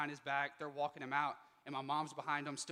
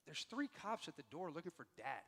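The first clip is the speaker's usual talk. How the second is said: soft, scared, barely voiced; hushed worried report; 10 dB quieter than usual. Son in the doorway telling his parents, hushed.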